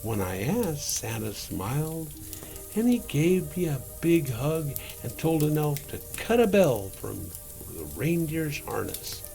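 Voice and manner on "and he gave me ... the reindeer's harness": usual speaking voice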